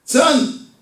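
loud, high-pitched call to a son; forceful call. Acted. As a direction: cry out to your son as his father. Father calls out to his son.

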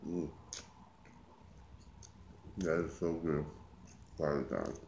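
talking behind hand; muffled while eating; mouth full, chewing. Real, not acted. Seen 0: Chews after a bite; hand covers mouth at 0.1, 1.6, 4.0-4.6.

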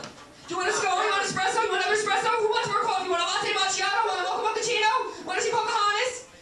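high chirpy barista voice; mock barista. Mocking a Starbucks barista.

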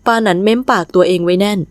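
usual speaking voice

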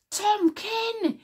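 high, full-voiced shout of a name; roared. A narrator's reading. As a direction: roar it out to someone far off. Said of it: "he roared".

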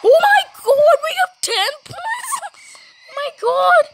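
high, loud laughing shout; thrilled shout. Real, not acted. A gamer celebrating ten points, high, loud laughing shout. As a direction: scream with joy at winning.

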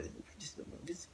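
whispered, trailing off. Real, not acted. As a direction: let it fade into a whisper. Trailing off, giving up.

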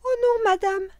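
put-on very high, loud child's reply; high-pitched child voice; little girl. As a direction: answer as a small girl, right up high. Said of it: "lui répondit l'enfant".

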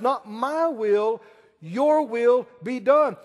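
slow, leaning on my and your; repeated prayer. Acted. Preacher repeating Jesus' garden prayer to the church, slow and weighted. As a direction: pray it over and over, slowly. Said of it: "Again, three times".

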